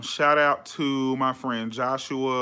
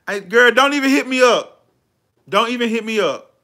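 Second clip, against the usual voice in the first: much louder and higher, scolding; exasperated outburst; 9 semitones higher, 10 dB louder than usual. Real, not acted. Telling fans off for messaging later, loud and exasperated. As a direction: tell them off, loud and fed up.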